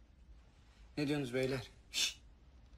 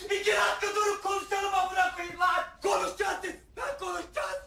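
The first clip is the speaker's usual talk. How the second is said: high-pitched exasperated yelling; strained shouting; 5 semitones higher than usual. Acted. Begging the crew to stop and talk, strained shouting. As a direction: shout over a brawl to make them stop.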